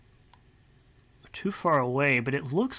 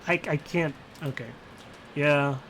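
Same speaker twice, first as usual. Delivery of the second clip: ordinary delivery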